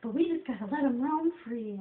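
very high, loud put-on voice; impersonated therapist. Therapist checking in with a loved one, high-pitched impersonation. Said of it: "I like impersonating people".

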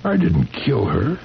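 rising high and urgent; anguished denial climbing in pitch; pleading protest. Acted. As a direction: beg the sheriff to believe you. Husband pleading innocence to sheriff.

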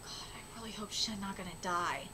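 real whispered worry; worried hush. Real, not acted. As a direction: murmur anxiously to yourself.

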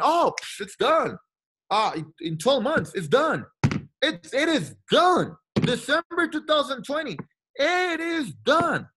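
a hammed-up know-it-all insisting it is done; cocky boast. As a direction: play the overconfident rookie who says it's done. His cocky younger self answering.

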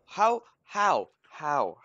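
high, very loud outburst, easing slightly; baffled shouting. Real, not acted. Player stuck at an obstacle, shouting in disbelief.

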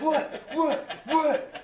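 very high, loud repeated yelps; mock startled yelling. Sleepers woken inside body bags, mock startled shouts. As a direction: fire back startled, high-pitched questions.

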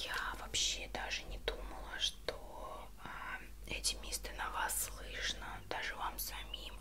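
ordinary talk

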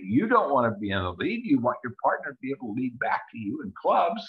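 usual speaking voice

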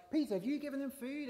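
hammed-up squabbling question, pitched right up; bickering. Disciple Andrew questioning Peter over food, mock bickering. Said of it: "sort of bickering conversation".

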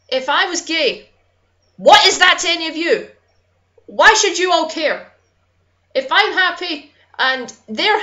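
worked-up, indignant rant; real anger. Real, not acted. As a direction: demand answers in real outrage. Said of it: "I'm sorry I'm getting so angry".

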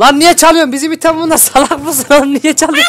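high, loud scolding. Real, not acted. Friend scolds the thieving baby, high angry yell.

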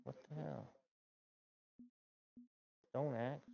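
ordinary delivery

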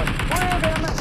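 startled shout. Real, not acted. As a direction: yell it as you go down. Player gets knocked in game.

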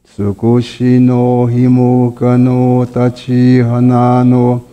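usual speaking voice

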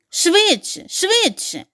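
high urgent call; dog calling the duck to hurry. A narrator's reading. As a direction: hurry them along at full voice. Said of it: "загукав Чорниш".

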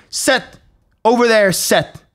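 high, sharp dog command; authoritative command. Retold or imitated. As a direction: order the dog firmly about. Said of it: "just be very authoritative".

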